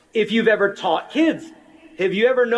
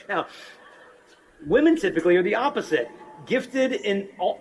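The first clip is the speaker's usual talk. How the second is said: ordinary talk, nothing marked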